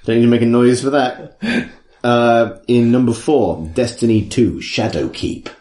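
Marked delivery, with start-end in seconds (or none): 4.6-5.5 low dramatic title read; ominous low voice. Pretend. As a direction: growl the subtitle low and soft.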